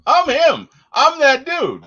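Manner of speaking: high, loud triumphant boast; cocky realization. Retold or imitated. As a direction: crow like a newly confident hero. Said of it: "see him figure out".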